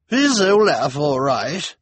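high, slow, called across the room; calling across room. A narrator's reading. Missus Morrow calling across the room, high. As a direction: call out from far away. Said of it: "Missus Morrow called".

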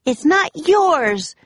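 high, drawn-out protest; shout. A narrator's reading. Annie protests to Captain Bones, shouting. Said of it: "shouted Annie".

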